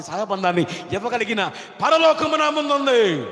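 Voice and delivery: phrase by phrase, swelling into a high shout; impassioned preaching that ends in a shout; building sermon climax. Real, not acted. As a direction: build fervently to a ringing peak.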